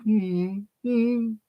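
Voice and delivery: high, slow mock British accent. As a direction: put on a fluting British voice, drawn out. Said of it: "He talks with a British accent".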